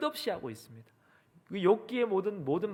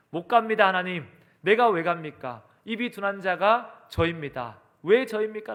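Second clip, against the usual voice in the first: loud, higher acted objection; emphatic pleading refusal, voice raised; protesting; 4 semitones higher, 7 dB louder than usual. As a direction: push back at God as a reluctant Moses. Moses protesting God's call, acted.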